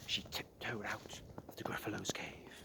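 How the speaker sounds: whispered, sneaking narration; tiptoe whisper. A narrator's reading. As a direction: hush as she sneaks out.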